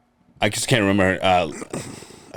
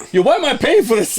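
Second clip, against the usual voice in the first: high, louder mimicked protest; mock indignation; 5 dB louder than usual. Teasing co-host over the sauce charge, mock indignation. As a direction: imitate an outraged customer.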